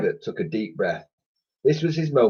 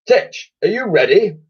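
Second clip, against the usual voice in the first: commanding admiral; 11 semitones higher, 5 dB louder than usual. A narrator's reading. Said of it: "asked the Admiral".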